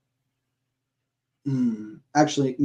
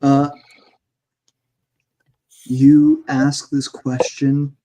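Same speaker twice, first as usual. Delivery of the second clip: ordinary delivery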